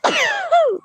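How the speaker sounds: sudden loud high sneeze; sneezing. Real, not acted. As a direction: sneeze sharply mid-sentence. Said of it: "pardon, je vais éternuer".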